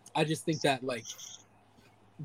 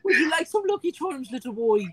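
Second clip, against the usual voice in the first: high, hammed-up Irish voice; Irish accent; 7 semitones higher than usual. Offering a little boy cereal in a mock Irish accent. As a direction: put on a mock Irish lilt. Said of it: "we gotta say something in Irish".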